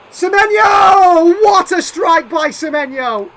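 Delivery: huge, high, drawn-out goal scream; goal shout. Real, not acted. Gamer celebrating his team's equaliser, screaming goal call. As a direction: scream the scorer's name as it hits the net.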